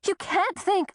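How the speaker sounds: a sudden high cry of disbelief; shocked protest. A narrator's reading. Woman stunned by her doctor's accusation, high disbelief. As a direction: blurt it out, stunned and indignant.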